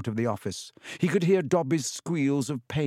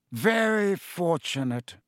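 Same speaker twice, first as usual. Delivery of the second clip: stiffly; 6 semitones higher, slower than usual. A narrator's reading. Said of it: "he said stiffly".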